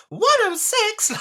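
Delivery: very high, loud, slow disbelief. Retold or imitated. Host shocked at the episode count, very high. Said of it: "and I was like".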